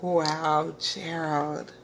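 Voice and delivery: ordinary delivery, nothing marked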